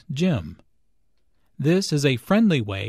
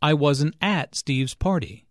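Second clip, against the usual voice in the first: "at" emphasized in "I wasn't at Steve's party"; stress on AT. Shown as a demonstration. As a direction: lean on the normally weak word "at". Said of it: "the emphasis here is on at".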